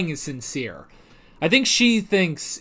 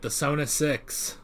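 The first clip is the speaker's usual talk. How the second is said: softer and slow, savoring the name; 2 semitones lower, 9 dB quieter than usual. Shown as a demonstration. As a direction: roll "The Sona Six" out softly and slowly, relishing it. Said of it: "That has a really good ring to it".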